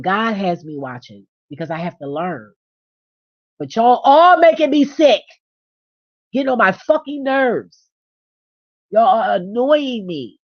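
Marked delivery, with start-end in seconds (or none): 3.6-10.4 loud, high, real angry outburst, slowing on the last line; furious rant. Real, not acted. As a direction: let the irritation boil over and shout at them. Ranting at annoying viewers.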